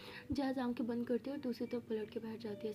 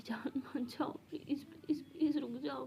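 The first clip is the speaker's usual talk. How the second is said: whispered pleading; 2 semitones higher, slower than usual. Acted. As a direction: plead with her not to leave, hushed. Girlfriend begging lover to stay.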